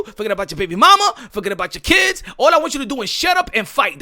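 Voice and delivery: loud, ranting orders; mock bossy fans. Voicing fans demanding Garcia just fight, loud. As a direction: shout them down at him.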